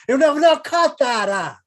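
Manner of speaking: high, loud, slow mock scolding; hammed-up Benny protest; Jack Benny impression. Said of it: "the joke becomes".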